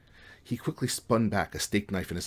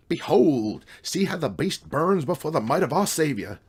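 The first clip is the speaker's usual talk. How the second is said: loud, high, hammed-up Southern preacher cry; booming mock Southern evangelist; bad Southern accent; 8 semitones higher, 7 dB louder, slower than usual. A narrator's reading. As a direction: declaim it in a fake Southern accent. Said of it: "cried in a bad Southern accent".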